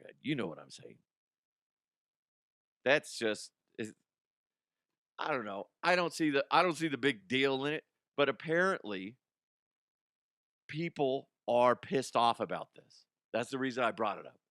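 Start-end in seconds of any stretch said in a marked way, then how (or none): none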